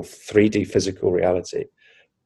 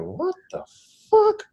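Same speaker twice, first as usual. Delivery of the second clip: high, squeaky, stunned astonishment; retold disbelief. Looking at own changed body, incredulous. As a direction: let it climb very high in mock shock.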